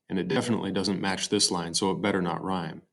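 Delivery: ordinary talk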